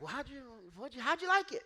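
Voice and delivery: high, loud eager questioning; mock eager dinner host; eager church guys. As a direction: pepper him with keen questions.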